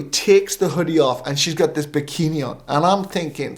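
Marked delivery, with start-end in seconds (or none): none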